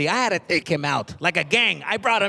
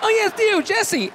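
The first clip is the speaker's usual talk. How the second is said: high, bright-volume teacher voice; chirpy teacher; 14 semitones higher, slower than usual. Retold or imitated. Teacher asking class about summer, chirpy. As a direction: address the class like a kindergarten teacher.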